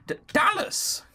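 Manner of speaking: high-pitched stammered exclamation; startled cry. Acted. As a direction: blurt the name out high, startled. Startled cry in story reading.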